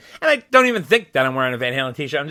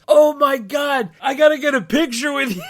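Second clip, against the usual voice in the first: gushing retold fan, pitched way up; starstruck excitement; 11 semitones higher than usual. Fan asking the podcast host for a picture, starstruck excitement.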